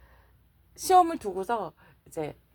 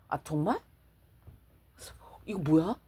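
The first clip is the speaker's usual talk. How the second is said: hushed, unvoiced "what is this?"; a startled hushed murmur; startled whisper; 4 semitones lower, 6 dB quieter than usual. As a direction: whisper it as if something just spoke to you. Startled on hearing the voice.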